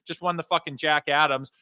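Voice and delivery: ordinary talk, nothing marked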